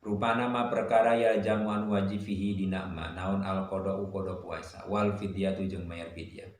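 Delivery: usual speaking voice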